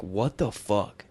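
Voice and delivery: slow, soft, stunned what the fuck; stunned disbelief. Retold or imitated. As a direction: let the shock sink in, slowly and softly. Discovers wallet is gone.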